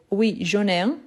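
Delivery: ordinary talk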